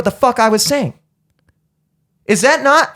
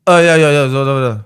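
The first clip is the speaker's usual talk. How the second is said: dismissive mumbling; 5 semitones lower than usual. Retold or imitated. Customer imitating the clerk who waves him off, dismissive mumbling. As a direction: imitate a brush-off, slurred run of yeahs. Mimics cashier's dismissive babble.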